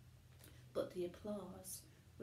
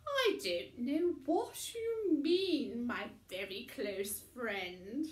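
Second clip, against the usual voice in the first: higher, louder, slow nervous denial; squirming Missus Crumb; 5 semitones higher, 8 dB louder than usual. A narrator's reading. Mother caught out by the tramp, squirming and high. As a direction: wriggle out of it. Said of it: "squirmed Missus Crumb".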